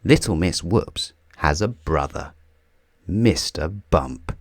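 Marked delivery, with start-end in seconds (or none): none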